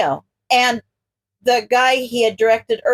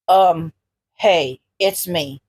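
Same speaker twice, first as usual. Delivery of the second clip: low, gruff hero voice; 6 semitones lower, much slower than usual. A narrator's reading. Ground Zero brushes off Izuku's apology, low and gruff. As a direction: shrug it off, rough and casual.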